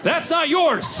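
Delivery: high feeble yelled protest; mock yell. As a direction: shout weakly at the thieves. Imagined confronting car thieves.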